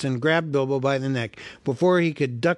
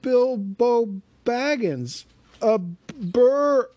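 high, slow stammer of fright; a little hobbit trembling before trolls; frightened hobbit; 10 semitones higher, 5 dB louder, much slower than usual. Acted. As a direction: Bilbo answers the trolls in fear. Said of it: "said poor Bilbo, shaking all over".

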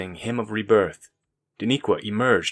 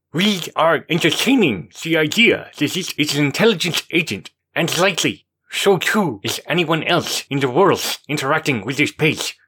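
higher, louder pompous man; Markov's voice; 6 semitones higher, 4 dB louder than usual. Acted. Markov lectures the group, higher and louder. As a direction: hold forth with a full mouth of ideas.

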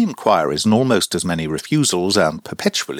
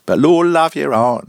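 higher and louder Lord love your heart; louder retake; 8 semitones higher, 5 dB louder than usual. Shown as a demonstration. Reader tries the keeper's exclamation with more force. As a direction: punch "Lord" hard and high. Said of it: "repeated takes".

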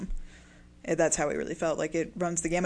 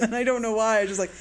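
normal talking voice

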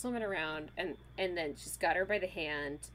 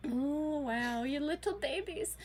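a lightly voiced, higher cooing aunt; mock cooing; 7 semitones higher, slower than usual. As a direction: coo down at them like they're toddlers. Older women cooing at them.

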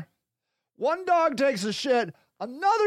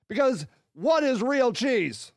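normal talking voice

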